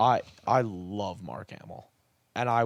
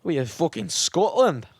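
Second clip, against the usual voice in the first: put-on loud, high Scottish accent; 8 semitones higher, 5 dB louder than usual. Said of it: "it's a Scottish squirrel".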